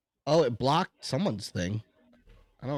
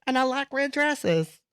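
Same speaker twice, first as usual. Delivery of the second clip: mocking the woman; 6 semitones higher than usual. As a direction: parrot her circular talk, higher. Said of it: "She's, like, talking in circles".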